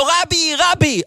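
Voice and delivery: high mock yell calling the rabbi. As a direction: cry out Rebbe, Rebbe at the door. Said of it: "צועק לו".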